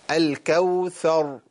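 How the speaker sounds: slow الكوثر ending on a dark, still ر; measured الكوثر, final vowel dropped; heavy stopped ra. Shown as a demonstration. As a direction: finish الكوثر with a clear heavy ر. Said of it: "موقوف عليها بالتفخيم وموقوف عليها بالتسكين".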